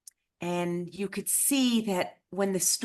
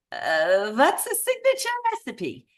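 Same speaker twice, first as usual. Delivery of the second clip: high-pitched, comically bad Eastern European accent; Muppet-chef take on an Eastern European accent; 5 semitones higher than usual. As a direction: do a puzzled survivor in a hammed-up Eastern European accent. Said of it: "I do the absolute worst Eastern European accent".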